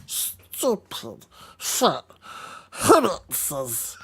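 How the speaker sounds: lightly voiced Gollum, hissing a little; a hint of a spiteful Gollum. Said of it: "Lord of the Rings impressionist".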